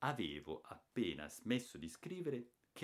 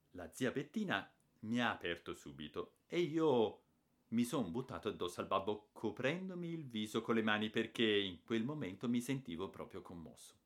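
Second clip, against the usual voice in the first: usual speaking voice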